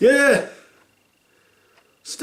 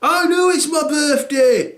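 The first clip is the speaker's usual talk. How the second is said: put-on grumpy teen; 12 semitones higher than usual. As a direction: groan it. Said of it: "He went".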